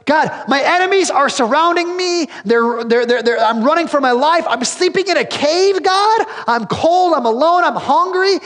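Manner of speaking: high, urgent pleading to God; anxious psalm voiced high and loud; desperate cry. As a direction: call out like a man hunted and alone. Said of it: "David's like".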